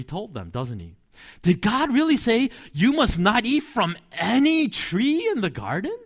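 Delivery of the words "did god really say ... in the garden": higher, sly exaggerated serpent question; a hint of a sly snake; mocking serpent voice. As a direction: lean on the twist like a sneaky tempter. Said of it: "Like, wow, that's really harsh".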